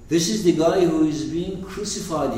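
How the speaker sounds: usual speaking voice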